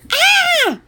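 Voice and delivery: piercing high "aah"; very high, loud shriek; horrified yell. Acted. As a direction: scream in total embarrassment. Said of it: "yelled Andrew".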